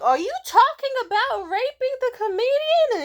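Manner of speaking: very high, loud incredulous shout; outraged yell. Retold or imitated. Confronting a drunk heckler, high incredulous yell. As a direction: yell in disbelief at what you just heard.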